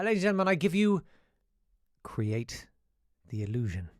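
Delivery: announcing in a light Irish accent. Shown as a demonstration. Host introducing the impression, in an Irish accent. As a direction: present the show title in an Irish lilt. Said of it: "I can attempt an Irish accent".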